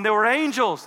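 acting overwhelmed and agitated; freaking out. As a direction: blurt it out, frantic with news. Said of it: "Now, she's freaking out".

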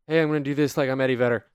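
rushed, mumbled aside; quick mumble. Acted. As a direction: mumble it fast, as if sneaking it into the microphone. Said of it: "quickly, quickly, like, mumble into the microphone".

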